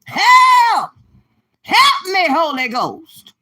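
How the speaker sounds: hammed-up high scream, stretched out loud; screaming liver. Liver calling on the Holy Ghost, comic desperate scream. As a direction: scream high, then drag out the last words. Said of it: "that liver over there saying".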